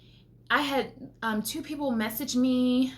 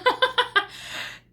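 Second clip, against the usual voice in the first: laugh; 12 semitones higher, 3 dB louder than usual. Real, not acted. Laughing with delight.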